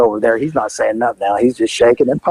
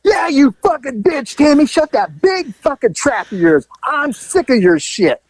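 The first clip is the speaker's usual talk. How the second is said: high, slow furious insults; angry yelling back; 10 semitones higher than usual. Retold or imitated. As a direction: bark it at her across the street. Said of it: "he goes".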